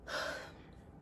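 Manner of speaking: a long, soft, breathy sigh; tired sigh. Real, not acted. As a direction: let the air out wearily. End of a sad story.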